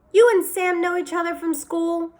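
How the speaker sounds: high, kindly mother voice; a waitress chatting with a boy; friendly waitress. A narrator's reading. Waitress voice in story.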